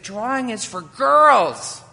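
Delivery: put-on taunting boy. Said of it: "He said".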